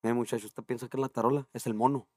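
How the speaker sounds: lightly voiced serious musician putting the kid in his place; serious Pepe. Pepe coolly answering the drummer kid, lightly voiced serious tone. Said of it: "le dice al Pepe".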